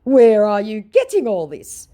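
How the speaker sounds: slow, loud, high put-on scammer; mock scammer. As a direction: voice the scammer, drawn out. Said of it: "he goes".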